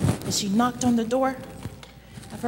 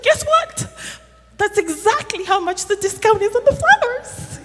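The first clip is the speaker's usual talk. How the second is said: much higher, bursting with joy; excited shopkeeper; 10 semitones higher than usual. Acted. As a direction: announce the surprise gleefully. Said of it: "She's like".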